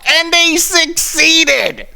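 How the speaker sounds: high, slow, breaking into laughter; laughing. Real, not acted. Mocking the reviewer who took the bait, high laughing. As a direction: let the words dissolve into a laugh.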